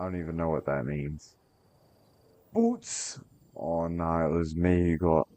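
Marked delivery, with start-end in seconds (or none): 2.5-3.2 very high sudden yelp; excited yelp. Real, not acted. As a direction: squeak the word up high. Excited shout in game.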